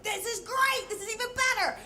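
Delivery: high-pitched excited outburst; thrilled delight. Retold or imitated. Narrator finding cocaine in the checkbook, thrilled delight. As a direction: squeal it like a huge windfall.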